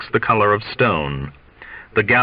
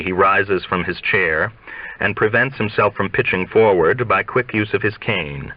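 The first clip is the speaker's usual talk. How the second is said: plain reading voice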